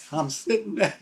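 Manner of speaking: high, real laughter while telling it; bursting into a real laugh mid-story. Real, not acted. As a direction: laugh through the line at the memory. Laughing at his own story.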